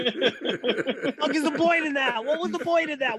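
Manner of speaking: whiny exasperation. Retold or imitated. Voicing the guy's complaint, laughing.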